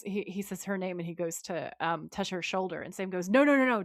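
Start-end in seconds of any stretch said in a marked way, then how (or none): 3.3-3.8 loud, raised string of no's; urgent warning. Retold or imitated. Sam stopping Trevor touching Nora, acted urgent warning. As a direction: cut in quickly to stop someone.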